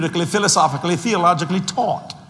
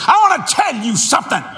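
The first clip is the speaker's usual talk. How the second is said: wife's angry voice; 8 semitones higher, 4 dB louder than usual. Retold or imitated. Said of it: "She said".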